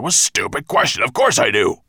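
quick, loud, irritated dragon; impatient monster scorn; grumpy outburst. A narrator's reading. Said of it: "grunted Nettlebrand".

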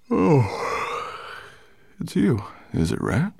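sleepy, slightly hoarse dragon; hoarse murmur. A narrator's reading. Dragon just woken by rat, hoarse murmur. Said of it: "he murmured in a rather hoarse voice".

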